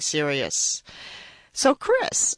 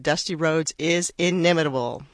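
normal talking voice

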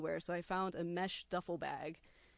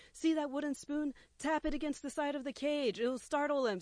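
higher, urgent instructions; hurried orders in a frenzy; frantic orders to girlfriend; 9 semitones higher, 5 dB louder than usual. Retold or imitated. Frantic bird wrangling.

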